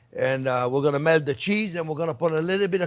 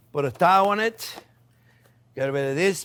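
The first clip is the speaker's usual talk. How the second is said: ordinary talk, nothing marked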